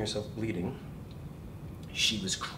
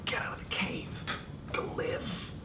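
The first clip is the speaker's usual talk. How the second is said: softer urging whisper; joy voice whisper; 6 dB quieter than usual. Acted. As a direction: whisper encouragement to go live. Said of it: "Your joy voice is saying".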